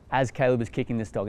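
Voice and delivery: normal talking voice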